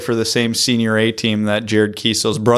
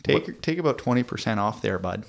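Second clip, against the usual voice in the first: put-on Canadian accent; 8 dB quieter than usual. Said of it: "heavy Canadian accents".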